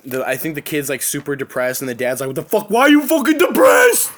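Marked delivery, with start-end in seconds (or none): 2.3-4.1 high, loud exasperated yell; hammed-up dad outburst, building to a shout; imitated angry dad. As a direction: yell it like a fed-up father. Acting out the movie dad.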